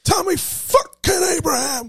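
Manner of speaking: excited. Real, not acted. Hyping favourite striker.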